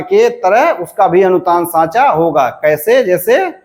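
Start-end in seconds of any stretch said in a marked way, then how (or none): none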